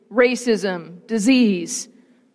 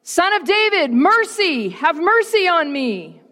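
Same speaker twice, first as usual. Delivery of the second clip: much higher pleading call, contained in volume; insistent crying out; 7 semitones higher than usual. A narrator's reading. Hushed beggar persists in calling to Jesus, crying out. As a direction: call out higher, more desperate to be heard. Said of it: "but he yelled all the louder".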